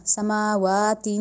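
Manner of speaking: سماواتن held, the ن carried through the nose; nasal tanween. Shown as a demonstration. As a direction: hold سماواتن and hum the end nasally. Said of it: "اس والی ادائیگی پہ ہم نے رکنا ہے اور ناک میں آواز".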